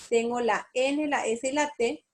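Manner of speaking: ordinary delivery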